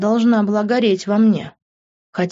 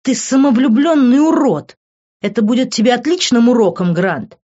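loud, raised-pitch telling-off; heated, insulting rebuke; angry scolding; 5 semitones higher, 5 dB louder than usual. A narrator's reading. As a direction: scold him with indignation. Angry scolding of drunken boyfriend.

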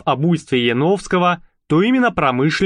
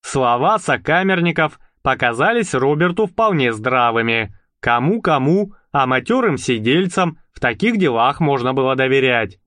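usual speaking voice

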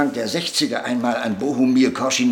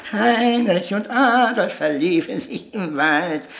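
mocking nursery rhyme, sung-spoken; rhymed verse recital; 4 semitones higher than usual. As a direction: recite the rhyme higher, in a singsong lilt. Recites puppet play verse.

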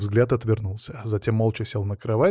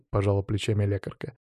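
plain reading voice

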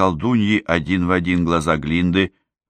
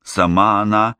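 ordinary delivery, nothing marked

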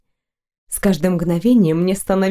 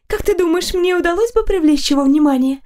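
put-on girlish voice; 9 semitones higher than usual. A narrator's reading. Girl dreams of the knight.

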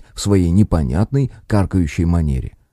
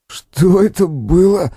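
much higher, slow groaning question; moaning; 10 semitones higher, much slower than usual. Acted. Kostik knocked flat asks what happened, moaning. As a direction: moan it out, dazed and hurt. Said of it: "простонал Костик".

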